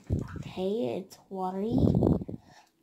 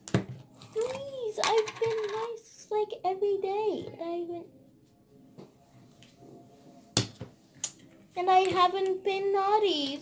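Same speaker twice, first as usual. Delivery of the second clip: pleading; 8 semitones higher than usual. Pretend. Begging Santa before opening.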